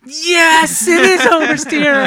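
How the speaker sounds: very high, loud and thrilled; delighted. Retold or imitated. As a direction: squeal with proud joy. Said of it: "I was like".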